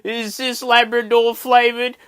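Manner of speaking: high, loud, slow wail of a question; hammed-up teary panic; mock crying. Panicking to old mate about dog biscuits, mock crying. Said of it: "I'm crying at this point".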